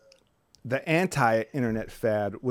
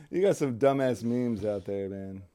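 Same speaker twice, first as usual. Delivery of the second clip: ordinary talk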